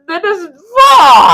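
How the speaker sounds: loud, high, slow cry; laughing shout. Real, not acted. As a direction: yell it through laughter. Laughing outburst about turning thirty.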